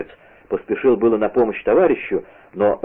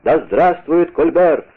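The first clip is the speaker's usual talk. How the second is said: higher, mildly raised rallying cry; shouting loudest; 6 semitones higher, 3 dB louder than usual. A narrator's reading. Gang leader rallying his mob, raised shout. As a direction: lead the mob, drawing out the last cheer. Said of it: "кричал громче всех".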